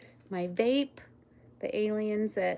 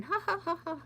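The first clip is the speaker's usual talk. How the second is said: high, teasing mock laughter; mock alien laugh; 11 semitones higher than usual. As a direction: cackle like a gloating little alien. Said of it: "laughing and going".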